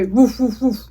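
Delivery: imitating a dog bark. Shown as a demonstration. Dog barking for class.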